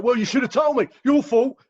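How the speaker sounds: loud, high-pitched accusing outburst; indignant mock blaming; acted attack. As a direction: go on the attack, throwing the blame back. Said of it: "start attacking you".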